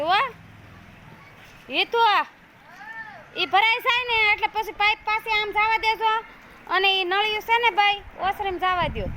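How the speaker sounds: calling out. Real, not acted. Calling to kids across yard.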